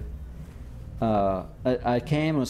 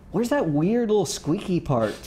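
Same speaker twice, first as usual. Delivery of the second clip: higher-pitched imitation of Leonard asking; Leonard's puzzled question; 8 semitones higher than usual. Imitating Leonard asking the band where the squeaky part is, higher-pitched. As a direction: raise the pitch and sound baffled.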